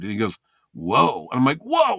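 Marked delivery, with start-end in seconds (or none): 0.7-1.3 low, understated whoa; Keanu's "whoa". Retold or imitated. Actor reacting to hearing about furries, low whoa. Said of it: "He said, "Whoa". 1.6-2.0 high, thrilled whoa; amazed delight. Retold or imitated. Teller thrilled the actor said it, amazed.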